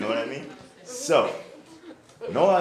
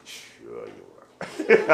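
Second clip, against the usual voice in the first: sarcastic; 11 semitones lower, 7 dB louder, much slower than usual. Pretend. Teasing student.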